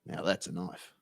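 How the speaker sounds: low, soft, punch on "that's"; Crocodile Dundee impression. Quoting the movie to viewers, low and proud. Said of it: "Paul Hogan".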